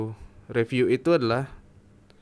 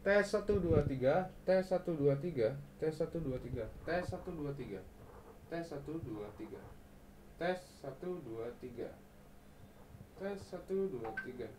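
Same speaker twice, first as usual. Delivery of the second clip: counting, fading ever softer with distance; moving away from the mic; 4 semitones higher, 14 dB quieter than usual. Shown as a demonstration. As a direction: back off from the mic while counting.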